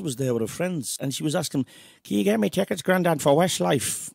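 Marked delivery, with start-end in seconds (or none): 2.0-4.1 higher-pitched, hammed-up Belfast accent; badly faked Belfast, loud and stretched out. Granddaughter asking Grandad for concert tickets, bad Belfast accent. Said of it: "that was my bad Belfast accent there".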